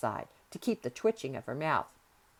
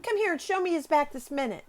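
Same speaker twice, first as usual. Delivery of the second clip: high-pitched, insistent order; imperious command; 10 semitones higher, 4 dB louder than usual. A narrator's reading. Girl ordering nurse to the bedside, imperious command. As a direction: order the grown-up about, voice well up. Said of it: "she commanded".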